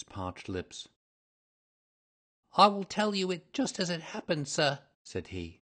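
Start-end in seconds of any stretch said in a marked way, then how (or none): 2.6-4.8 higher, anxious suspect's voice; high-voiced, cowed suspect; nervous confessing culprit. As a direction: begin confessing nervously and respectfully. Frightened culprit begins his confession.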